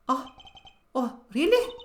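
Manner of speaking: loud mocking Russian-accented oh really. Witness mocking the lawyer in a Russian accent. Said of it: "she doesn't have a Russian accent".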